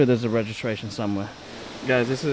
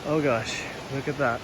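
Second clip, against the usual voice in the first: usual speaking voice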